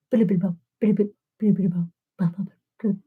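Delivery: soft wet lip-blubbering into the mic; imitated mouth noises. Mocking the man at the microphone, soft blubbering mouth noises. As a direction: mimic tiny smacking mouth sounds. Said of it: "he's making all these mouth noises".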